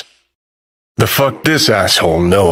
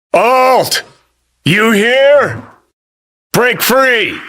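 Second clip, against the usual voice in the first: high, urgent shout into the distance; calling out; 13 semitones higher, much slower than usual. Acted. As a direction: call out for someone you cannot see. Calling out to Alt.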